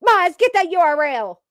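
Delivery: mocking moderators. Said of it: "They're like".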